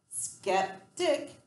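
"Skeptic" in two parts, a clear gap between; split into syllables. Shown as a demonstration. Teacher sounding out a word for spelling, "Skeptic" split in two syllables. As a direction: break "Skeptic" after "skep", each syllable crisp. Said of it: "two syllables".

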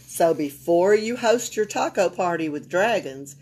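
ordinary delivery

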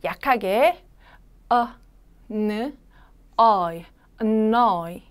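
어, 느, 어이 spelled out slowly with no force; weak, relaxed syllables. Shown as a demonstration. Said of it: "강하지 않고 약하게 힘을 빼면서". (힘을 빼고 약하게)

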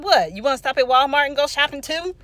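loud, quick sarcastic outrage; fussing brother. Retold or imitated. Brother mocks the errand on the hospital drive, fussing. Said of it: "He fussed about that".

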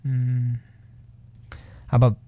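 boomy, close to the microphone; bass-heavy close mic. Real, not acted. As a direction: lean right into the mic. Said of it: "You now sound like you're leaning directly into the microphone".

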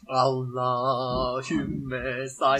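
slow prayer. Real, not acted. Prays before last test.

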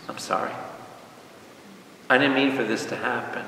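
soft, subdued last apology; quiet despair. Acted. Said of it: "as the noose is around his neck".

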